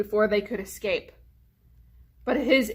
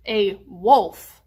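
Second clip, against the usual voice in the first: usual speaking voice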